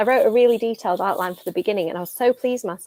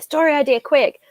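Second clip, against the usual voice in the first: quick voice memo; 6 semitones higher, 5 dB louder than usual. Retold or imitated. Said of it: "I'm like".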